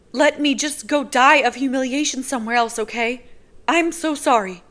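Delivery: humiliated woman backing away; mortified girl. Acted. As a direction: want to vanish with shame. Nazira mortified.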